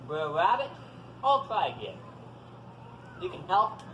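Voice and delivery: plain reading voice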